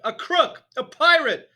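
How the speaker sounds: very high, very loud scream; shrieking headmistress; screamed accusation. A narrator's reading. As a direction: scream it at him. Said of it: "the Trunchbull screamed".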